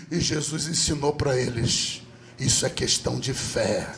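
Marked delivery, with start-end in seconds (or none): none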